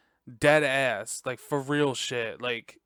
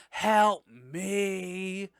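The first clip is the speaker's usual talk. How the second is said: higher, drawn-out cry for help; mock call for help; 5 semitones higher than usual. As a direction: call out for help in pretend, stretching each word.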